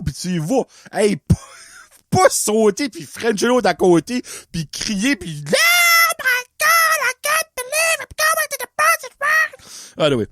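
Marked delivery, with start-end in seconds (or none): 5.5-9.5 squealing falsetto, ecstatic; high-pitched game show squeal; shrieking like a thrilled contestant; gushing contestant. Retold or imitated.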